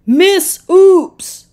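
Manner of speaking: high, loud decisive call; cry out. A narrator's reading. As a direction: call out with new resolve. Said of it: "he cried".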